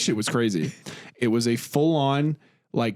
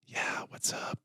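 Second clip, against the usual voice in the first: very soft, voiceless whisper; straight-up whisper; 13 dB quieter, slower than usual. Shown as a demonstration. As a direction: greet in pure breath, no voice at all. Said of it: "as opposed to just straight up whisper".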